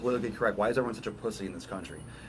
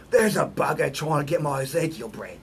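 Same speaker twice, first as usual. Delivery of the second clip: mock Australian accent, a touch higher; 4 semitones higher, 5 dB louder than usual. Vlogger imitates an Australian kangaroo-fighting shopper, mock Australian accent. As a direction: ham up an Australian accent for the exclamation. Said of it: "make fun of me for my English accent".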